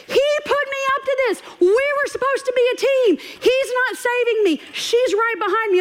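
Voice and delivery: high-pitched indignant panic; hammed-up high-voiced alarm; mock panic. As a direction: play a betrayed kid on the run. Child fleeing mom, betrayed by dad.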